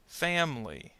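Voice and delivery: "Family." said as FAM-lee; "Family." in two syllables, without the i; dropped middle syllable. Shown as a demonstration. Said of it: "the I in family disappears".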